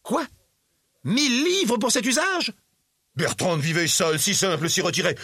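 high-pitched, incredulous outrage; indignant protest. A narrator's reading. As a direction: protest the figure in shrieking disbelief. Outraged nephew disputes the accounts. (protestation indignée, voix très aiguë)

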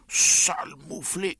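high, loud, drawn-out insult; slow, explosive angry shout; furious yell. A narrator's reading. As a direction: yell it in outrage at the child. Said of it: "hurla oncle Vania".

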